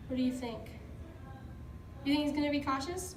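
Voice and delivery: normal talking voice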